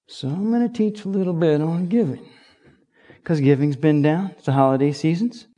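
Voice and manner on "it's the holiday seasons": ordinary delivery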